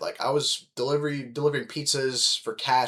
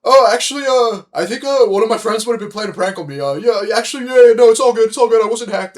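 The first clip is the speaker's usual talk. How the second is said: high, loud, flustered backpedalling; panicked voice change; 9 semitones higher, 11 dB louder than usual. Retold or imitated. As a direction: cover up in a squeaky rush of excuses. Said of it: "I hear my voice change".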